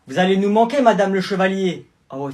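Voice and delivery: usual speaking voice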